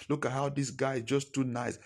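normal talking voice

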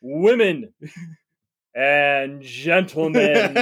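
slow, grandiose pompous orator; drawn-out blustery politician address; Colonel Starbuckle. Acted. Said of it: "Colonel Starbuckle".

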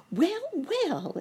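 a tiny ant exclaims in a high voice; exclaiming ant. A narrator's reading. Ant surprised to see Uncle Wiggily, high exclaim. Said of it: "exclaimed a voice all at once".

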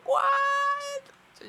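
very high, loud, stretched disbelief; retold shock. Storyteller reacting to the second insult, high shocked squeal. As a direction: squeal it in exaggerated astonishment.

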